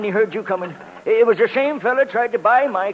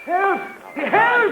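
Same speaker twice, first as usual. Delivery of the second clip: high frantic cries for help; calling for help; 11 semitones higher than usual. Acted. Old man yelling for help as thugs take his car. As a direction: yell for help as thugs grab you.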